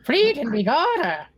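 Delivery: high-pitched mock Irish leprechaun cry; leprechaun-style Irish accent. Game master demonstrating a dwarven thief, mock Irish leprechaun accent. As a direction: squeal it like a cartoon Irish leprechaun. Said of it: "Make you sound like a leprechaun".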